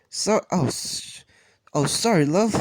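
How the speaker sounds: put-on English accent again. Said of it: "Sorry that I did a Canadian accent".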